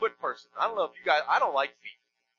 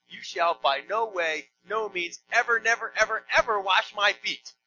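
slow start building to loud, high "ever, never, ever, ever"; emphatic piled-up negatives; 4 semitones higher, 4 dB louder than usual. Shown as a demonstration. Preacher spelling out Peter's double negative, hammering "ever, never". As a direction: punch each "ever" and "never", louder as it builds. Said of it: "actually a stronger negative".

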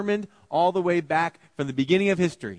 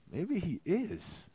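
soft, slow, higher-pitched musing; hushed inner thought; 5 semitones higher, 6 dB quieter, much slower than usual. Acted. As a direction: let the doubt creep in slowly. Pilate's imagined inner thought.

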